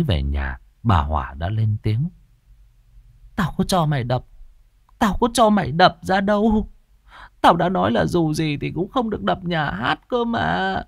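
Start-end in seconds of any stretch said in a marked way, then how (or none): 3.4-10.8 high-pitched, indignant scolding; angry mother. A narrator's reading. Mother angrily scolding her son over tearing down the theatre, indignant. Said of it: "Bà Hỏa ra chiều tức giận lắm".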